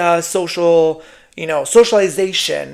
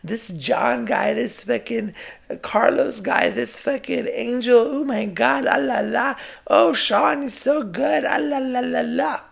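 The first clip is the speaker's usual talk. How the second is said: high, sing-song gushing; mock swooning over online idols, soft and babbling; fawning fan; 7 semitones higher, 4 dB quieter than usual. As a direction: gush in a high baby-talk coo. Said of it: "we fawn over the screen".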